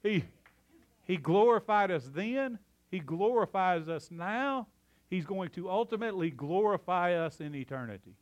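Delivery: a touch of hellfire-pulpit rhythm. Real, not acted. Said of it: "I feel like an old-timey preacher today".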